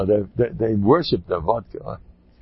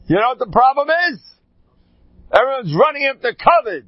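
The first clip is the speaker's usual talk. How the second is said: fired-up preaching; 11 semitones higher, 4 dB louder than usual. Real, not acted. Preaching climax about covid.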